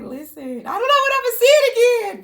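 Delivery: high, loud mock exclamation; imitating herself shopping. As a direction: squeal it like a bargain hunter. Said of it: "I'll be like".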